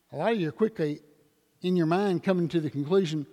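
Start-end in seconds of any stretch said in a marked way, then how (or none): none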